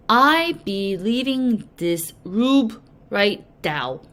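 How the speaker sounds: slow, blocked-nose voice; a lightly done stuffy-nose voice. Acted. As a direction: say it as if your nose is plugged. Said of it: "my nose suddenly and involuntarily closed".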